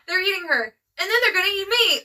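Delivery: hammed-up horror. Quoting overacted film line as quiz clue.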